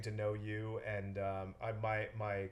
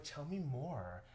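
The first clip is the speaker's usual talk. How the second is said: slow, soft, swooning "tell me more"; slow breathy swoon of a charmed client; smitten buyer; 3 semitones higher, 7 dB quieter, much slower than usual. Retold or imitated. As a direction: melt as if charmed by the accent. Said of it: "And she's like".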